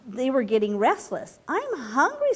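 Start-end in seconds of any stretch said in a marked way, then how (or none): 1.5-2.3 high small whiny frog; little frog voice. A narrator's reading. Young frog complaining to parents in a high little voice. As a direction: pipe it up like a small hungry frog. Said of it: "said one of the frogs".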